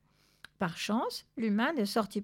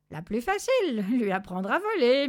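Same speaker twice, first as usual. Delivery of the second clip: louder, higher ironic meow of a retort; 4 semitones higher, 6 dB louder than usual. A narrator's reading. Zorbas answers about teaching flight, ironic. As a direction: throw it out with loud irony. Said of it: "miaula ironiquement Zorbas".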